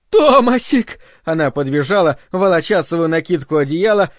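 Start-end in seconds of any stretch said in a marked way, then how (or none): 0.1-1.0 high, loud call of a name; desperate cry. A narrator's reading. Linda running into his arms, desperate. Linda cries out, runs to him.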